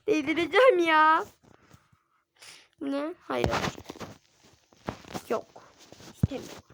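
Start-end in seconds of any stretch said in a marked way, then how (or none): 0.1-1.2 louder, near-crying whine; frustrated whine. Real, not acted. Kid near tears over the game, whining louder. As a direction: whine like you might cry.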